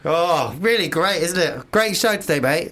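hammed-up matey small talk; playing a cheery toilet neighbour; mock chummy urinal chat. Pretend chatty urinal banter.

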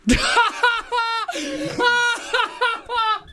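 long, high-pitched burst of laughter; helpless real laughing fit in repeated bursts. Real, not acted. Presenter cracks up after caller's "Oh, no", high helpless laughter. Reacting to the trail offer.

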